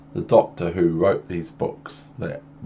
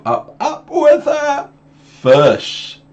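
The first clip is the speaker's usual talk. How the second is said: high, loud, slow showman announcement; showman cat; 7 dB louder, much slower than usual. Acted. Cat announcing his game to the children, high and showy. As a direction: announce the trick with big showman flair.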